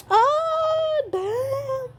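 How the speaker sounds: very high, drawn-out fangirl squeal; swooning squeal. Real, not acted. Swooning over the singer.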